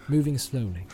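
ordinary delivery, nothing marked

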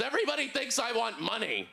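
high-pitched imitation of an angry stranger; mock furious. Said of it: "he gets really mad".

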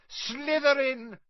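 high, slow, drawn-out scream; hat screaming. Acted. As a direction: shriek the house the instant it touches him. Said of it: "when it screamed".

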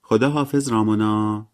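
lingering, sing-out goodbye; drawn-out. A narrator's reading. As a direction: stretch the farewell out long and high. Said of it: "مری جین با صدای کشداری گفت".

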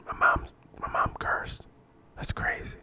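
hushed, breathless whisper. Real, not acted. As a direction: whisper it like a shocking secret. Awed aside about mom cursing.

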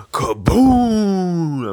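loud, high shouted explosion noise; mimicking an explosion, loud and high; kaboom effect. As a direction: belt out the blast like a cartoon bomb.

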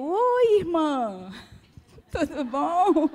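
sweet phone greeting. Retold or imitated. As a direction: switch instantly to all sweetness on the phone. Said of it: "Desse jeito". (cumprimento doce e agudo ao telefone)

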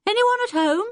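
high called-out hail into an empty house; calling out. Acted. Boy calling into the empty cottage, shouting. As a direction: call out to whoever might be inside. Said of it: "Julian gave a shout".

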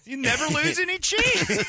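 slow, high pitchman voice; Flex Seal guy. Acted. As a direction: stretch out the sales pitch. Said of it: "the Flex Seal guy".

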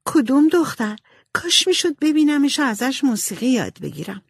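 Beth voice. A narrator's reading. Beth asks Laurie, eager.

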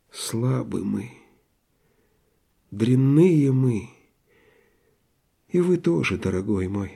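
почти шёпотом, медленно, с горечью; тихо и медленно, как приговор себе; тихое сокрушение. Acted. (медленно и тихо, с горечью)

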